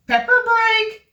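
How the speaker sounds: high, slow announcement; sing-song announcement. Real, not acted. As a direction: call it out high and stretched. Announcing a break.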